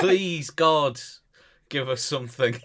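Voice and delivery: loud, high mock plea to the heavens; mock prayer. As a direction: beg God for a punchline.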